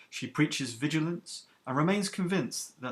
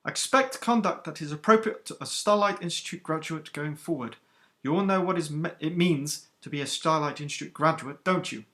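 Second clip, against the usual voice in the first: stern captain, higher and louder than the narration; iron-voiced captain; 3 semitones higher, 5 dB louder than usual. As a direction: rebuke the recruits who kept chattering. Captain addresses the trainees.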